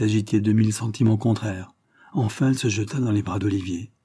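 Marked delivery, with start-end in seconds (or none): none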